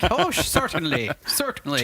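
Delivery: light Irish lilt on certainly; a hint of an Irish accent, polite and pleased. Host answers golfers in a hint of Irish accent. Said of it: "Well, that's Irish".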